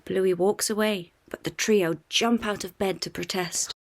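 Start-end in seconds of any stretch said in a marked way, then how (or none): none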